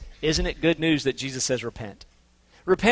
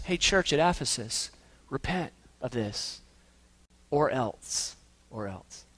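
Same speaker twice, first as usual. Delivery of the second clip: quiet warning; 5 semitones lower, 10 dB quieter, slower than usual. Acted. Jesus voiced warning the church.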